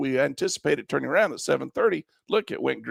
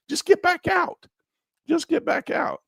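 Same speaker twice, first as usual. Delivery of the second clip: "Just get back out" jumped much higher, each word hit hard; emphasized; slower than usual. Shown as a demonstration. Teacher stressing to viewers how to exit a trade, "Just get back out" said much higher and punched. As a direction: hammer home "Just get back out", voice leaping up high. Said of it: "I gotta just emphasize this".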